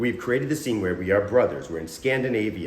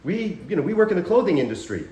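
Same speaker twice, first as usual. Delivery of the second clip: higher-pitched, baffled objection; mock disbelief; 8 semitones higher than usual. Improviser blocking the offer of a battle, mock disbelief. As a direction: protest in hammed-up confusion.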